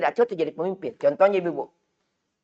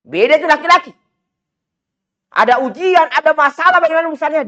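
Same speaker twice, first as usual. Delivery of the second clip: raised, loud sermon voice; rising preacher's fervour; fiery preaching; 8 semitones higher, 6 dB louder than usual. Acted. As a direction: preach it out high and hard to the crowd. Preaching at full force.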